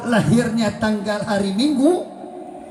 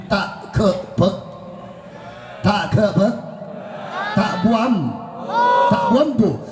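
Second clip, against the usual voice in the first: rising preacher shout; much slower than usual. Real, not acted.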